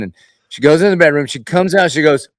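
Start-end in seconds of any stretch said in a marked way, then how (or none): none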